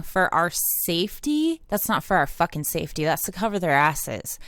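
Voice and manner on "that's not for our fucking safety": normal talking voice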